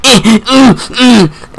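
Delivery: high strangled moaning imitation; mock seizure moans. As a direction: imitate him faking a fit with high groans. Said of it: "He's like".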